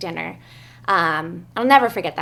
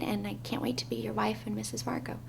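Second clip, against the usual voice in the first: whispered vow; 7 dB quieter than usual. Real, not acted.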